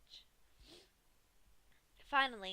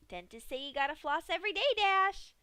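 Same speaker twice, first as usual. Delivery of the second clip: high, fast, louder mock advice; cheery Pinky voice; 7 semitones higher, 8 dB louder than usual. A narrator's reading. Pinky lectures Dash on flossing, cheery and high. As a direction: chirp it like a dental tip. Pinky joking while torturing.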